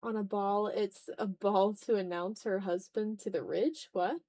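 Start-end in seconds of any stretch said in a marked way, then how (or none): none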